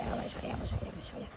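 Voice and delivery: breathy mock whisper of an intruder; a teasing whispered threat. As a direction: hiss it low like a creeping intruder. Intruder voiced, drops to whisper.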